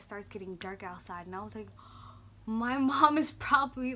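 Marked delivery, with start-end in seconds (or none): 1.7-2.4 sudden unvoiced gasp of dread; a quick breathless intake of shock; sharp gasp. Realizing mom is coming home. 2.5-3.9 retold panic. Panicking about mom arriving.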